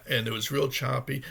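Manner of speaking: ordinary talk, nothing marked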